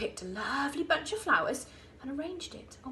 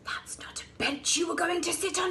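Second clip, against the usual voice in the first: bellowed; 6 semitones higher, 3 dB louder than usual. A narrator's reading. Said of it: "he bellowed".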